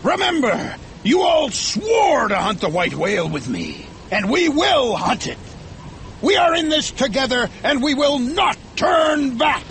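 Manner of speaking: high, emphatic captain's rallying cry; rally the crew with fanatical force; defiant, commanding shout; fierce shout. A narrator's reading. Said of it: "shouted Ahab fiercely".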